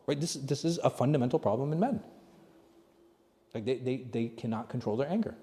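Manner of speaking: ordinary talk, nothing marked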